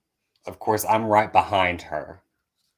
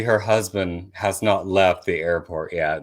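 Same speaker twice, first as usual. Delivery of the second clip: ordinary delivery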